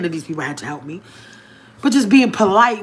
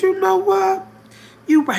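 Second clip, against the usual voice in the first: much higher, playful give-in; playful agreement; 13 semitones higher, 3 dB louder than usual. Retold or imitated. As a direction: concede with a playful lilt. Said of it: "I said".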